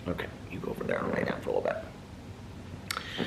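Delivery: soft whisper, sending the dog off. Real, not acted. Owner sending her dog to lie down, in a whisper. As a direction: hush it so the mic barely catches it.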